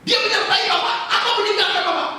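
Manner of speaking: high, loud pointed question. Retold or imitated. Pastor confronting a churchgoer, loud demanding question. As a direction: press him hard on where Jesus is.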